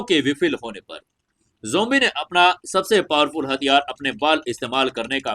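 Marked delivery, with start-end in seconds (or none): none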